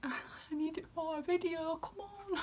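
high, soft, whiny begging; mock pleading. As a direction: beg like a whimpering child, hammed-up. Jokey begging for a reply.

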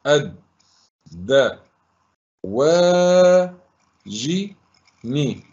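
each syllable alone, long pauses between. Shown as a demonstration. Teacher breaking a word into syllables for pupils, sounded out. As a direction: sound it out, holding the long vowels. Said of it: "فلننطق الكلمة صوتًا صوتًا".

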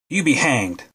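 a touch of a spiteful snap; spat-out curse. A narrator's reading. Cornered army cook snapping at the soldiers, mild spat-out curse. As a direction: read lightly as a cook spitting back a curse. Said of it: "spat out Ginger".

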